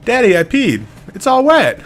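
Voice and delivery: put-on very high, loud little-girl voice; dad imitating his toddler daughter. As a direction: squeak it like a small child.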